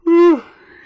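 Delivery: a loud, drawn-out yawn that slides down; big yawn. Real, not acted. Tired streamer yawning mid-story near one in the morning, loud yawn. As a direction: let a real late-night yawn escape. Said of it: "I'm really sorry that I'm yawning".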